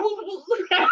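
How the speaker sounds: high, mock whooping cry; imitated screaming. Retelling Charles Ramsey's porch story, high mock scream. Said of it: "I heard somebody scream".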